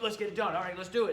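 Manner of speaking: eager client. Retold or imitated. Busy high-end client approving the job, eager. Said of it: "they're like".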